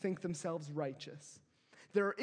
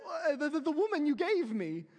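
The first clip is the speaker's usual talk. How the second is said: higher, louder hammed-up blame; mock a flustered excuse; whiny Adam passing the blame; 7 semitones higher, 5 dB louder than usual. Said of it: "Adam said".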